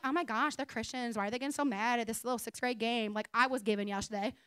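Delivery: quiet, judgy muttering aside; judgy murmur. Retold or imitated. Pastor mocking her own judgy remark, quietly. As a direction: mumble the judgment under your breath.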